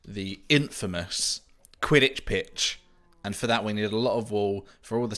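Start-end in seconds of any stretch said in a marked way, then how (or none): 0.1-2.8 grand announcement. Pretend. Dramatic naming of the next build.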